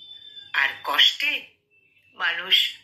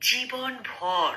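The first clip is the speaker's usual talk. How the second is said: জীবনভর stretched very slowly, each syllable held, falling at the end; drawn-out emphasis; 4 dB quieter, much slower than usual. Acted. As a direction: lean into জীবনভর and drag it out slowly. Repeated word drawn out for emphasis. (জীবনভর শব্দটা খুব ধীরে টেনে বলা)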